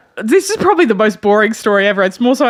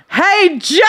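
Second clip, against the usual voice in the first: high, booming mock call across a store; hammed-up holler, pitched way up; enthusiastic boom; 7 semitones higher than usual. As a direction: imitate an overkeen salesman calling out. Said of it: "In the most enthusiastic, loud boom".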